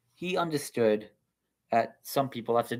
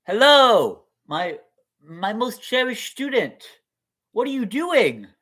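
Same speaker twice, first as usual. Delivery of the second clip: sweet rabbi greeting; 10 semitones higher, 6 dB louder than usual. Acted. Rabbi greets student at door.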